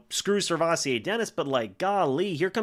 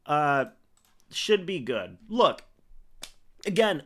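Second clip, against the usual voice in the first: ordinary talk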